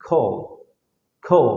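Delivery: "খল" with a rounded "o" vowel. Shown as a demonstration. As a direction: say "খল" with an "ও" sound, not "অ". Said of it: "ওকারের মতো হবে".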